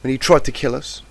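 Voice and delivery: high, loud, tearful outburst, slowing as the guilt builds; anguished scream. A narrator's reading. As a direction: scream it out, blaming yourself. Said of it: "the yellow Pegasus screamed".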